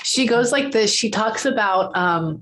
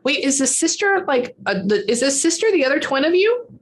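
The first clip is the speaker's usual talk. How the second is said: normal talking voice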